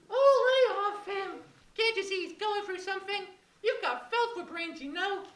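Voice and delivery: high, loud puppet voice. Acted. Puppet scolds the other puppet, high squeaky voice.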